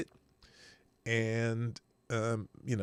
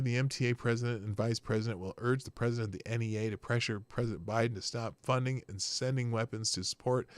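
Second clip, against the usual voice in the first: ordinary talk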